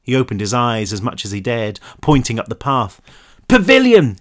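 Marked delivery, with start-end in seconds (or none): 3.5-4.2 loud, high shout. A narrator's reading. Cal shouting to Brick over the helicopter, loud shout. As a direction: bellow it over the roar. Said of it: "he shouted".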